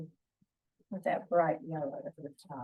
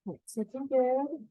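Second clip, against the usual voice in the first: pleased exclamation; 7 semitones higher, 7 dB louder, slower than usual. Real, not acted. Pleased with the painting.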